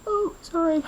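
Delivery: words stretched by a yawn. Real, not acted. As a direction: say it mid-yawn, high and loose.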